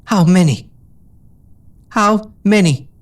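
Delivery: high, pressing repeated question; insistent. A narrator's reading. Injured mare pressing friend for an answer, insistent. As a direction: drive the point home.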